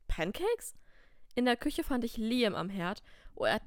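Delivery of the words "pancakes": sharp rising disbelief, much higher; can't believe there are pancakes; surprised question. Acted.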